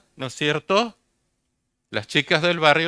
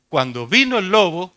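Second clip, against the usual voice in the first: ordinary delivery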